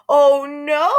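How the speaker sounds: high, loud horrified cry; panicked girl's scream. A narrator's reading. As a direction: shriek it in horror. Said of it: "Sheila screamed".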